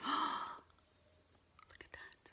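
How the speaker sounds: slow breathy whispered aside. Real, not acted. Reader whispering to child in wonder. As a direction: hush them with a gasp.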